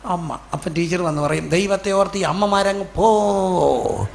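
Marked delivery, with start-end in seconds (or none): 1.5-4.1 drawn-out, rising "go away" plea; exasperated plea. Acted. Teacher sending mothers away from the gate, exasperated plea. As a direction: shoo them off, stretching and raising the last word.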